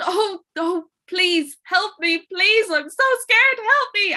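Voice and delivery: frightened merchant pleading; terrified plea. Acted. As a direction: beg for help, scared out of your wits. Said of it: "he ran up and he said".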